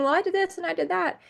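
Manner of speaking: a hint of a tearful woman pleading her case; imitating hysterical sobbing. Retelling a woman crying in the cop car, mildly mock-distraught. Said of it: "she looks like she's hysterical. She's sobbing".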